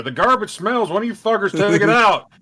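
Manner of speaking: high, loud complaint; mock irate parent. Parent nagging about the trash, high loud mock outrage. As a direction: yell it like a fed-up parent, playfully.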